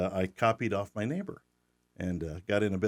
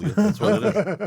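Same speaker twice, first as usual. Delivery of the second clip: laughing; 11 semitones higher, 8 dB louder than usual. Real, not acted. Laughing at his own joke.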